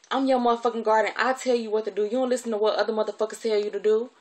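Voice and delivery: loud, quick-fire telling-off; angry scolding. Retold or imitated. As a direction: lay down the law as the irate guardian. Acted furious guardian asserting authority.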